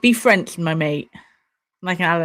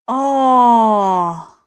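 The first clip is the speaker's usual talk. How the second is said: sudden loud cry of shock; shocked shout; 3 semitones higher, 5 dB louder than usual. Real, not acted. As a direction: blurt it out at the screen. Reacting to the awards on screen.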